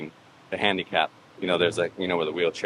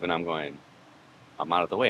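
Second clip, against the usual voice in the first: ordinary delivery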